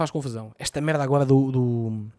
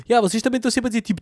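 mocking imitation; 8 semitones higher, 4 dB louder, much faster than usual. Imita os mais velhos a gozar.

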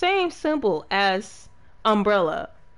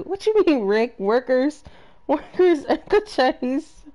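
incredulous; 5 semitones higher, 4 dB louder than usual. Real, not acted. Reacting to chat's claim.

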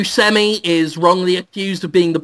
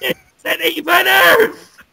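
gloating villain shout; 8 semitones higher than usual. Acted. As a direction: snap back in mocking triumph. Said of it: "He says".

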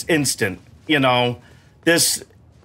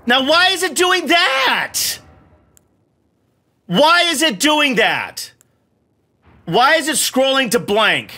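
slow, much higher, exasperated yell; frustrated shout; 9 semitones higher, slower than usual. Real, not acted. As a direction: bellow at the broken menu, dragging it out. Game menu misbehaving, frustrated.

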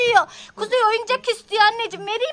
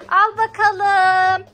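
loud, very slow, stretched vowels; drawn-out offering; 6 dB louder, much slower than usual. Pretend. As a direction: present it with a long, loud singsong stretch. Handing the bottle to baby.

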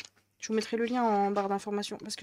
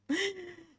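laugh; 10 semitones higher than usual. Real, not acted. Laughing at her to-do list.

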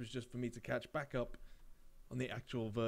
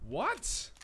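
surprised; 14 semitones higher than usual. Real, not acted. Shocked by game event.

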